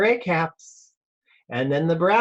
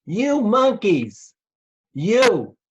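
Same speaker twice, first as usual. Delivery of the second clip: slow, mock scolding, a little higher; scolding monkeys; 5 semitones higher, 3 dB louder, slower than usual. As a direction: wag a finger at naughty monkeys. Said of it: "he said, shaking a finger at them".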